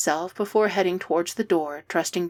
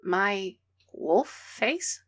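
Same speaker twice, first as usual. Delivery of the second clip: confused; 3 dB quieter, much slower than usual. A narrator's reading. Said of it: "frowns in confusion".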